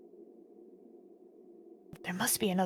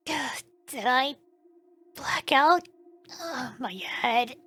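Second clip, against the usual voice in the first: ordinary delivery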